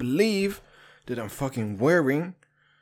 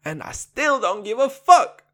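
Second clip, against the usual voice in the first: higher, louder defiant rap snarl; 11 semitones higher, 4 dB louder than usual. Retold or imitated. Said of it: "Like an [name] said".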